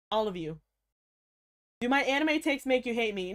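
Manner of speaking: ordinary talk, nothing marked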